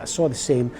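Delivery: usual speaking voice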